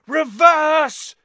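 high, loud urgent yell. Acted. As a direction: yell the command fast. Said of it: "Harry yelled".

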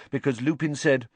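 ordinary delivery

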